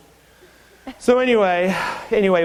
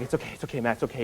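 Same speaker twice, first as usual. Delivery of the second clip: soothing coworker; 2 semitones lower, 9 dB quieter, much faster than usual. Retold or imitated. Martha comforting him.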